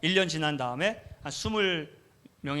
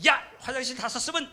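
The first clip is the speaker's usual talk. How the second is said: 화난 호통; 8 semitones higher than usual. Retold or imitated. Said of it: "제가 화가 나가지고".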